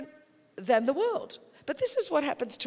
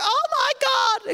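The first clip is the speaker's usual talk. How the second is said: much higher, louder, slow gasp of alarm; drawn-out horrified pitched-up gasp; mock panic; 11 semitones higher, 6 dB louder, slower than usual. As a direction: ham up the church's shock. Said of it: "the church is like".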